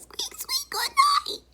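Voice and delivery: tiny, extremely high mouse voice; very high-pitched mouse squeal; squeaky mouse. A narrator's reading. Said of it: "And the mouse says".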